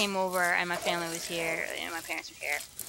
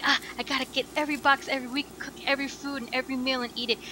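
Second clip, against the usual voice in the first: rushed; 4 semitones higher, faster than usual. Retold or imitated. Rushed inner voice quoting herself.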